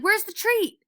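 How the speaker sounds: high, loud, eager demand; barking demand. A narrator's reading. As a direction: yell for the treat. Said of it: "Lance is barking".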